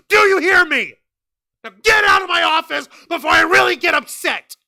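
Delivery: loud, furious promo, shouted at employees; furious boss promo. Pretend. Showing how Tony Khan should have scolded the wrestlers, with a loud, furious shout. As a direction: tear into your wrestlers like an enraged boss. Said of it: "I can fucking cut a promo better".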